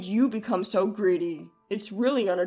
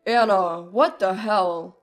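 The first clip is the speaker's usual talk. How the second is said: low, loud, slow demand; angry husband in a deep put-on voice; furious husband; 5 semitones lower, 6 dB louder than usual. A narrator's reading. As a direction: lower the voice and demand angrily. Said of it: "with a furious expression".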